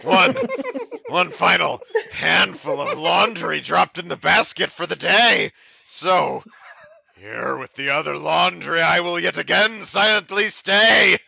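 mock-grand closing verse; poem recital. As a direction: recite toward a big, lifted last line. Reads a poem aloud.

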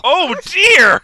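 high, loud mock gasp of shock. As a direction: clutch your pearls, scandalized.

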